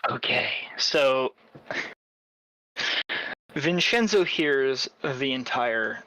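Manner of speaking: usual speaking voice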